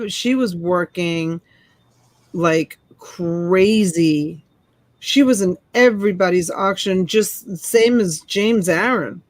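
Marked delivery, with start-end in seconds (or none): 0.1-4.4 slow emphasis. Real, not acted. Stretches for emphasis.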